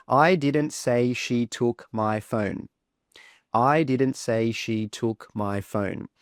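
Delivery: slow, even "I didn't say she took my phone"; no stress. Shown as a demonstration. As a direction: give every word in "I didn't say she took my phone" equal weight. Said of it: "say it with no stress first".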